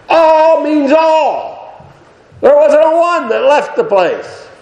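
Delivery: high, ringing emphatic cry; full-force preaching. Real, not acted. Preacher stressing to the congregation that none left, high cry. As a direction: stretch the words out and hammer the point.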